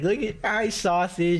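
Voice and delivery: put-on slow, high baby talk; baby voice to a dog. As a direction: coo at the dog. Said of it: "I'll be like".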